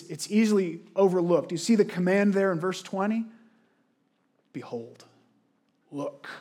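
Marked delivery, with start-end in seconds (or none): 4.5-6.3 soft behold. Shown as a demonstration. Preacher to congregation, hushing "Behold" softly. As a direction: drop to a hushed, much softer "Behold".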